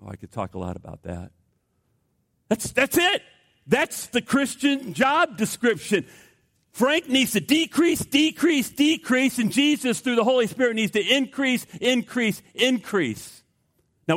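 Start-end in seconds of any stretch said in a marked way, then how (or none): 2.5-13.4 high, driving sermon delivery; impassioned preaching. Real, not acted. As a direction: hammer each repeated word with high-pitched fervour. Preaches at full force.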